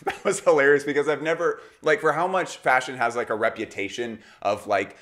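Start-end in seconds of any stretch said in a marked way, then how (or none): none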